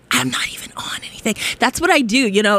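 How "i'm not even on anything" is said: quick soft whisper; catchphrase whisper. Real, not acted. Host whispering her catchphrase to listeners. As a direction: whisper it fast like a secret. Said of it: "that's my catchphrase".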